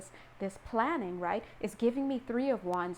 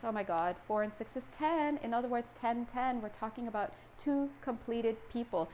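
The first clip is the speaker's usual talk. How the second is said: usual speaking voice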